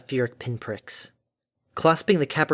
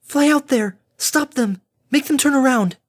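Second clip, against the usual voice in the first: high, loud, frantic orders; frantic shouting; 12 semitones higher, 5 dB louder than usual. Acted. Fluttershy orders Harmony to stop the visitors, frantic. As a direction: shout urgent commands at a high pitch.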